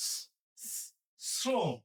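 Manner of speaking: isolated voiceless s twice, then শ্রম as srom; English S sound. Shown as a demonstration. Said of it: "ইংরেজি এস এর মতো". (শ্রম-এ ইংরেজি এস-এর মতো স)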